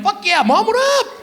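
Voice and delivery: very high, hyped-up hello; excited greeting. Acted. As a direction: pick up mom's call thrilled, pitch soaring. Delighted answer to mom's call.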